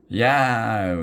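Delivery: a hammed-up cartoon yowl; troll yell. A narrator's reading. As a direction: yowl as the sparks sting. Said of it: "yelled Grog".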